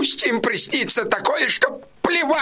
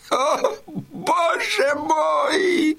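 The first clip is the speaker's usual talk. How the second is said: slow wailing cry of despair; drawn-out sobbing lament to God; anguished cry; 3 semitones higher, much slower than usual. Acted. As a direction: wail it out, crushed by grief. Yankel cries out in anguish.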